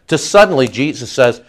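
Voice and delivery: normal talking voice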